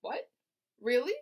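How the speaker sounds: high, loud startled cry; surprised cry. A narrator's reading. Wilbur hearing he won't die, high loud cry. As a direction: jump at the news. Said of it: "cried Wilbur".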